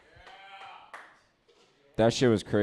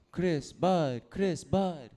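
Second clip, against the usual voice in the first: sing-song higher street guy calling Chris, bud; mock street guy call; 9 semitones higher, much slower than usual. Park guy calling to him, higher sing-song greeting.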